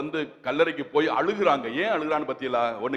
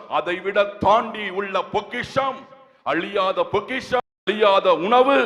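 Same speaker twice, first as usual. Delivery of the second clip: loud, raised sermon delivery; ringing exhortation, stretched key words; forceful preaching; 3 semitones higher, 5 dB louder, slower than usual. Acted. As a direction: drive each phrase home to the congregation.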